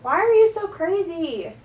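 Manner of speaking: loud, high-pitched call across the room; exasperated yell. Real, not acted. Vlogger to her screaming cat, exasperated yell. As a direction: yell it in real exasperation at a pet.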